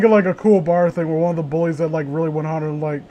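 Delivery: normal talking voice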